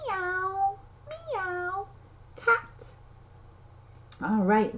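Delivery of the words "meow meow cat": high sung-out meows; high kitten meows for a toddler; cat meow. Acted. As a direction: mew like a playful kitten. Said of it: "And the cat says".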